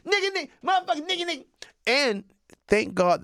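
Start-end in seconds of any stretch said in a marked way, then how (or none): 0.1-1.4 fast, high yelling; yelling kids. Retold or imitated. Kids yelling insults on the bus, fast and high. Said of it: "They're yelling".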